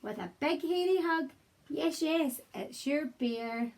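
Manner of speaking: plain reading voice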